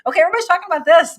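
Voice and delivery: a sudden eager jump into the hot topic; excited burst. Real, not acted. Excited rush into new topic.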